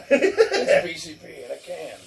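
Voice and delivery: loud laughing words. Real, not acted. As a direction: speak through a laugh. Laughing about vape flavor.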